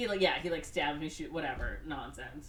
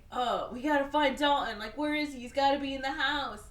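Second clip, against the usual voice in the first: mock worried goons; 7 semitones higher, 3 dB louder than usual. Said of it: "and they're like".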